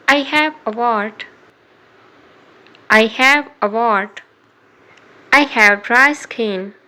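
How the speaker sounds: normal talking voice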